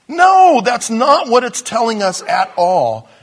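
loud, high drawn "No", then easing slightly; emphatic denial. Real, not acted. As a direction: cry out a firm correction. Rejects a mistaken reading emphatically.

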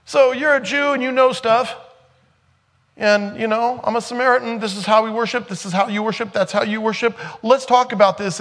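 high, loud mocking voice of the Samaritan woman changing the subject; mock woman dodging. Preacher mimics the woman at the well, high and mocking. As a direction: squeak it out like someone dodging the point.

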